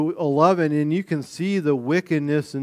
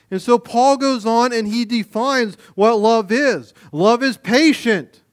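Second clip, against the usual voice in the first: higher, louder preaching build; 7 semitones higher, 5 dB louder than usual. Acted. Pastor urging believers how to treat each other, fervent. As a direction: lift it up like a call to the church. Preaching rises on love's meaning.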